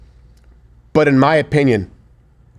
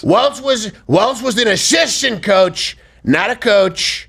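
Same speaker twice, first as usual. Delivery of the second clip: higher, slow Trump drawl; Trump impression; 8 semitones higher, slower than usual. Host reads Trump's post as Trump. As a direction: lean on each word like Trump. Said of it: "I can't do the voice".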